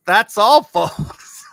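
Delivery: high, loud, breaking up into laughter; amused quote. Retold or imitated. Host reading out a cartoon actor's tombstone, laughing loudly. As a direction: burst out with it, dissolving into a laugh. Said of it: "It says".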